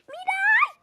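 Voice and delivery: high, loud call to wake her; shouting a name. Acted. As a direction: yell her name across the house. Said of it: "herkes niye bağırarak uyandırıyor". (Miray'ı bağırarak uyandırma)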